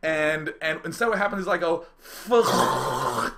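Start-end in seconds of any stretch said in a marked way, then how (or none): 2.0-3.3 drawn-out curse dissolving into rushing air; scream choked by air. Retold or imitated. Jumper's scream cut off by air in face, blown-out gust. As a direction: start the word, then get blasted by wind. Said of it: "air just shoves itself up my face".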